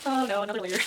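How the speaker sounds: ordinary talk, nothing marked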